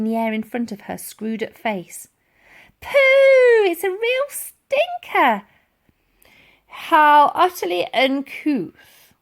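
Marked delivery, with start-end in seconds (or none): 2.8-5.5 much higher and louder, slow and squeaky; squeaky hammed-up revulsion; mock disgust. A narrator's reading. Elsie fakes disgust. 6.7-9.1 slow, louder, offended huff; a proper soldier scandalised; slow indignant huff. A narrator's reading. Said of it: "huffed the old soldier".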